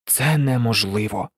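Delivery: low, understated groan of despair. A narrator's reading. Hero to himself, stuck for a plan, a low mild groan. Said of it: "застогнав він".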